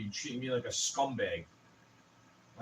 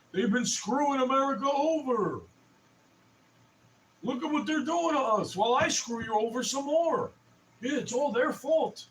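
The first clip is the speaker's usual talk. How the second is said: much higher and a bit louder sneering politician voice; mocking politician; 10 semitones higher, 4 dB louder than usual. Mocking a leader who blames other countries, high sneering. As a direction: ham up a blame-shifting leader. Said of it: "blaming everybody else".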